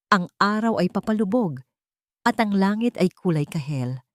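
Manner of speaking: usual speaking voice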